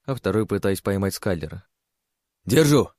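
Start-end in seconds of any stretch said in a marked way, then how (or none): 2.5-2.9 shout. A narrator's reading. Girl catching him mid-fall.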